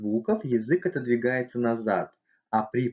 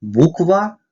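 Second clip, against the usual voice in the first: normal talking voice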